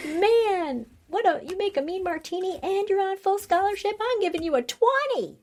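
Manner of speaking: mock Kimberly voice. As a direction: ham up a high, sugary voice for the whole line. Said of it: "So she goes".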